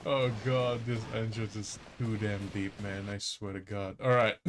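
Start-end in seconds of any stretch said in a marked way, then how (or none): none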